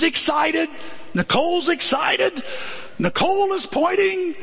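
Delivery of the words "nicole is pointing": ordinary talk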